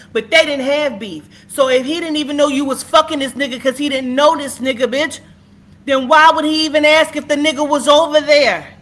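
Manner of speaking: real fury at the witness, screaming; screaming rage. Real, not acted. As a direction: let it all out at the top of your voice. Rant peaks in screaming.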